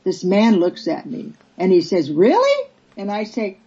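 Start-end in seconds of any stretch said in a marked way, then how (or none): 2.1-2.7 incredulous, squeaky-high question; astonished disbelief. Retold or imitated. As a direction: voice leaping high and louder on "Really?". Retold stranger's disbelief at free breakfast.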